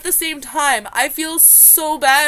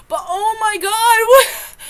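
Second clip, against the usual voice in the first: shocked shriek; 10 semitones higher, 10 dB louder than usual. Real, not acted. Shocked outburst.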